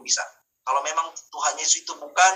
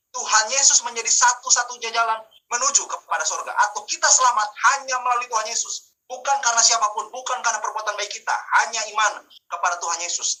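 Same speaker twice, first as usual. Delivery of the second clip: higher, insistent pulpit delivery; emphatic preaching; 5 semitones higher than usual. Real, not acted. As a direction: proclaim it with conviction to the congregation. Preacher declaring doctrine.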